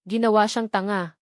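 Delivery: ordinary delivery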